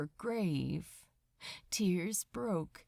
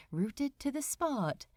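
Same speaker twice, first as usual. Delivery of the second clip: usual speaking voice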